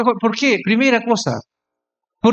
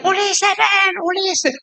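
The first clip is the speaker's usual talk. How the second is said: mock calling out; 10 semitones higher, much slower than usual. Youngsters calling their pastor to the ride, high mock shouting. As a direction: call someone over in a high, put-on voice. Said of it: "Y comienzan a gritar".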